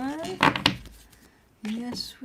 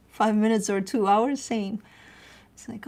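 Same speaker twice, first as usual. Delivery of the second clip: normal talking voice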